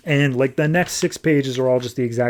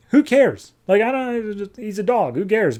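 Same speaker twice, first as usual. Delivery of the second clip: high-pitched, impatient brush-off; mock exasperated dismissal; 8 semitones higher than usual. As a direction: wave it away with a pitched-up voice. Character brushes off dog's death.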